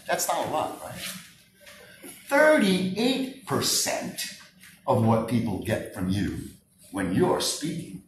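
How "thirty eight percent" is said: "Thirty-eight" stretched very slowly and higher; stress on thirty-eight. Shown as a demonstration. Speaker stressing the statistic to the room, very slow. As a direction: drag out "Thirty-eight percent" for weight. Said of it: "It's called the seven thirty-eight fifty-five rule".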